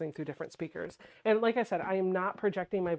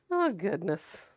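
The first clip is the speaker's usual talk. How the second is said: usual speaking voice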